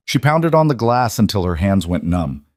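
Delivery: usual speaking voice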